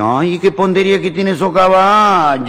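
put-on high, drawn-out Italian-accented admiration; Italian immigrant. Italian gardener watching horses at the fiesta, Italian-accented marveling. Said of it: "el italiano lo mira y dice".

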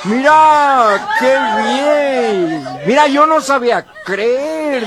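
high, loud, hammed-up marvelling; mock overjoyed exclamation; amazed delight. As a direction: marvel at your newly cured voice. Cured gangoso marvels at clear speech.

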